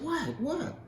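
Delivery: high startled what, what; jolted by unbelievable news; shocked disbelief. Retold or imitated. Preacher reliving hearing the old lady healed, high startled.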